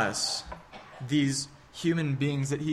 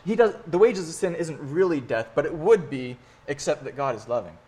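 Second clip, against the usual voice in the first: normal talking voice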